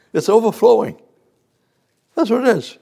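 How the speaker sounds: high, loud exultant cry; ecstatic. Real, not acted. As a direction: burst out with joy. Preacher exulting over verse.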